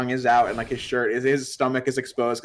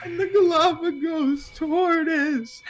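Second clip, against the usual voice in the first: put-on a much higher, slow, halting dumb kid; dopey high student voice; much slower than usual. Mocking a classmate's presentation, high slow dopey voice. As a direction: play a clueless student stumbling through a talk. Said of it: "continuing the presentation impression".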